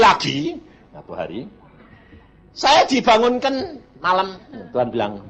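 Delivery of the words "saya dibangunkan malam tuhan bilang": ordinary talk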